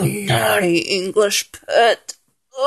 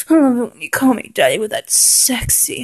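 ordinary talk, nothing marked

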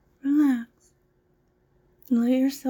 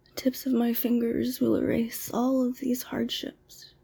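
ordinary talk

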